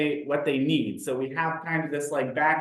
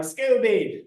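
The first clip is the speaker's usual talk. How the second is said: higher, louder sing-song nickname; playful nickname call; 8 semitones higher, 4 dB louder than usual. Retold or imitated. Teacher calling an adult by a teasing nickname, higher and louder. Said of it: "if I'm like".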